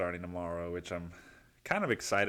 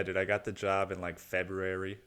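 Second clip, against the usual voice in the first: ordinary talk, nothing marked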